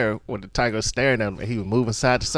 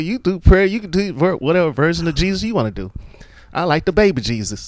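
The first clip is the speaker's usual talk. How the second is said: high, loud hammed-up grandfather; high mock old man; 8 semitones higher, 4 dB louder, faster than usual. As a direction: squeak it out like a cranky grandpa. Character voiced in prayer scene.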